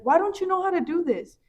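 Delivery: put-on impatient big sister scolding a small kid. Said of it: "I'd be like".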